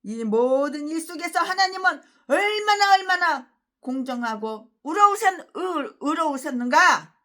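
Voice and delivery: a hint of a pompous preacher, slow and drawn out at the end; mocking the pastor's sermon. Preacher mocking a rival pastor's sermon, mildly hammed pulpit tone. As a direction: parrot the pastor's solemn pulpit line. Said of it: "그 씨가 뭐라고?".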